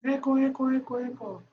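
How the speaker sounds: high calls fading away; fading echo. Acted. The cave answering back, high and fading away. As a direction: let eco die off like a cave echo. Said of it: "¿Y qué contesta Eco?".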